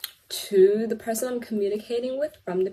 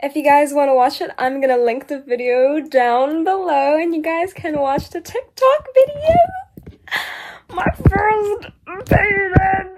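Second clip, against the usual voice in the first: over-excited announcing; 7 semitones higher, 10 dB louder than usual. Real, not acted. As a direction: belt it out, rising with excitement. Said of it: "I don't know why I'm speaking like that".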